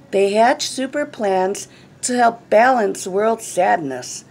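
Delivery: usual speaking voice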